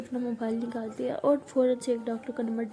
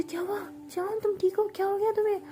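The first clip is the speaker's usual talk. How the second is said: high, alarmed questions; panicked worry; 8 semitones higher than usual. Acted. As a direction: rush in scared for her. Frightened girlfriend asks what happened.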